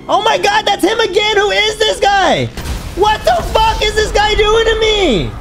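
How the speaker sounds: high, slow, loud panicked yelling; frantic high-pitched freak-out; panicked shouting. Real, not acted. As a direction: scream it as he keeps coming at you. Chased by another player in game.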